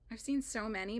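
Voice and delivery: normal talking voice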